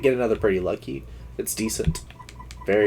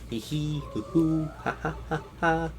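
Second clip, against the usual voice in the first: mock giggle; 4 semitones higher, 5 dB quieter than usual. Mock laugh while knocking Goombella off.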